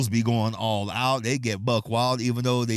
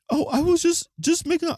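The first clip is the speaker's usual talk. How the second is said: put-on much higher, flustered old lady voice. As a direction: stammer like a startled old lady. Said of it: "She's like".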